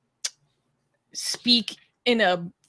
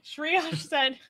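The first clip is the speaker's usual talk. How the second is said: ordinary talk, nothing marked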